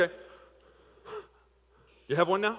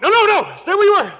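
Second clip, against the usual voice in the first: high, loud stop-right-there; urgent shout; 19 dB louder than usual. Real, not acted. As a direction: yell to freeze him in place. Stopping volunteer from moving.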